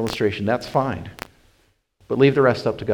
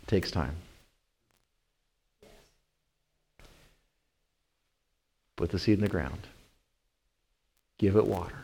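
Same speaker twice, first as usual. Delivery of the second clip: quiet preaching; 5 dB quieter than usual. Acted. Quiet reflective sermon passage.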